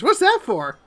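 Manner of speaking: put-on a small child asking innocently; child poking a tail; curious child. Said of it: "pokes her tail and says".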